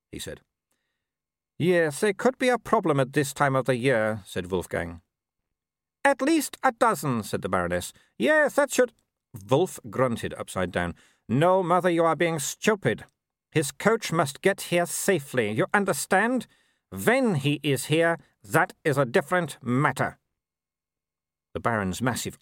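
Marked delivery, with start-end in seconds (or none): 1.6-4.2 lightly voiced young werewolf noble, cool; a hint of the arrogant son. A narrator's reading. Son answering his mother, lightly voiced young noble. 6.0-8.9 high, disdainful aristocratic lady. A narrator's reading. Mother scorning Vimes to her son, high haughty lady. 11.3-20.2 lightly voiced young werewolf noble, cool; a hint of the arrogant son. A narrator's reading. Son answering his mother, lightly voiced young noble.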